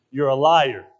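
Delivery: loud, pointed accusation; retold accusation. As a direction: land it hard, as if pointing right at them. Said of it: "pointed right at them and said".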